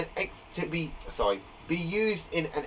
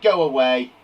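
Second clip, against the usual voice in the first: loud bossy order; bossy command; 6 semitones lower, 8 dB louder than usual. Shown as a demonstration. Teacher showing bossy verbs to the class, commanding. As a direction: send them off firmly. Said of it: "It's like those bossy verbs".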